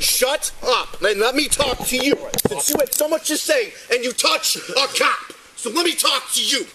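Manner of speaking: loud, furious commands at the man; angry shouting. Real, not acted. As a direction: shout him down, real anger. Officer yelling at arrested man.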